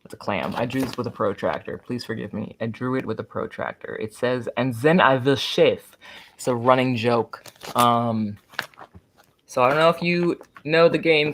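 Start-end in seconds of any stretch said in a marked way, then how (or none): 4.6-6.0 put-on slow, loud comic Russian accent. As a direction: quote it in a mock Russian accent. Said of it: "this hilarious, like, Russian".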